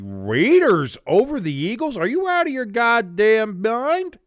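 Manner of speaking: high, loud, drawn-out disbelief; incredulous outburst. Acted. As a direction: blow up in outraged disbelief at a terrible pick. Mock outrage at a listener's pick.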